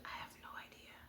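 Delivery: breathy hushed whisper. Retold or imitated. Whispering to her friend on the phone. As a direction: whisper it, barely audible. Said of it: "And I whispered it".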